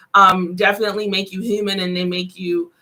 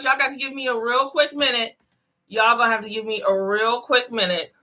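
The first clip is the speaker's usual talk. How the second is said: usual speaking voice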